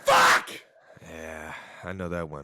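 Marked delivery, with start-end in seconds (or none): 0.0-0.5 very high, loud comic curse; mock frustrated yell. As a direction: yelp the swear in exaggerated frustration. Mock outburst at team's setback.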